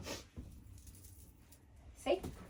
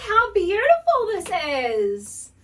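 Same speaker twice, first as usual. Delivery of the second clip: slow, high and amazed; drawn out in wonder; awed delight; 8 semitones higher, 5 dB louder, much slower than usual. Real, not acted. First look at dress.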